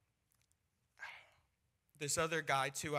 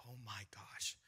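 whispered to himself in dread; dismayed whisper; 4 semitones lower, 7 dB quieter than usual. Retold or imitated. Young evangelist seeing the scary shirt seller, dismayed whisper. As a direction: breathe it under your breath, overawed.

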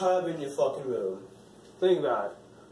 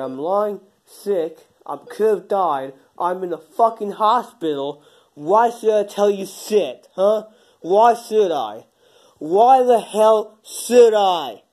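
slow and louder, rising into a yell; building angry yell; 4 semitones higher, 8 dB louder, slower than usual. Acted. Hospitalized man refuses to tell his friend, building to a yell. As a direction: drag the words out, getting angrier.

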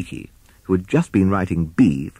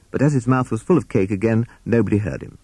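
plain reading voice

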